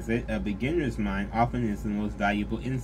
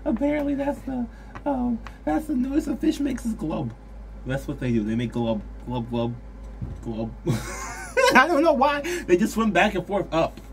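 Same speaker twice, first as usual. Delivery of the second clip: talking through high giggles; can't stop laughing mid-sentence; 12 semitones higher, 3 dB louder than usual. Real, not acted.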